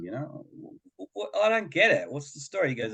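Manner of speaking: usual speaking voice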